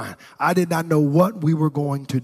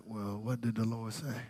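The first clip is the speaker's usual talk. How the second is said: wary, hushed; 4 semitones lower, 14 dB quieter than usual. Retold or imitated. Reluctant giver asking spouse what God said, wary and hushed. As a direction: imitate a reluctant spouse, much softer and lower.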